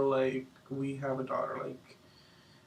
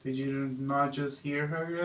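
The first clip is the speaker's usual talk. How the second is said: ordinary delivery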